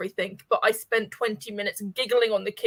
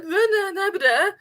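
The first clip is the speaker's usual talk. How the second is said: higher-pitched wordless slurring; drunken babble; 10 semitones higher than usual. Retold or imitated. Drunk friend insisting to a sober one, mock slurred babble. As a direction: babble vowels as if trying to talk drunk. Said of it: "I thought I was saying full words".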